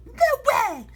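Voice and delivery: very high, loud defiant shout. Acted. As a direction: refuse her loudly. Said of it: "shouted Negative One defiantly".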